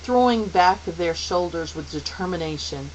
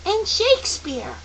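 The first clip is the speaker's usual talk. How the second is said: put-on high-pitched, eager child; bright-eyed pupil's high shout; calling out. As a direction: call out an answer excitedly. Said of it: "Charles Wallace called out".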